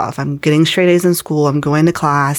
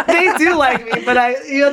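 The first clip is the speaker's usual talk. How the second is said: higher, through real laughter; laughing; 10 semitones higher than usual. Real, not acted. Correcting herself about her grandparents, laughing. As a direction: laugh while taking it back.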